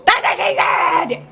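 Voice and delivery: high laughing shout of the punchline; laughing scream. Retold or imitated. Vlogger screaming the joke at Darlene on her knees. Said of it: "and I screamed".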